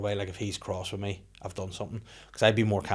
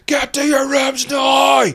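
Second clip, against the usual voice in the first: huge drawn-out roar, pitched way up; T-Rex shout; 15 dB louder, much slower than usual. Retold or imitated. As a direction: bellow the order like a roaring dinosaur. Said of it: "I called the T-Rex shout".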